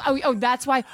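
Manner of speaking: normal talking voice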